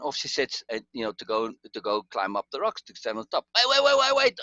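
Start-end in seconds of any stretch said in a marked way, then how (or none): 3.6-4.4 high, loud panicked wait; panicked yelling. Retold or imitated. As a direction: shout to stop her in a panic. Panicked producer stops her.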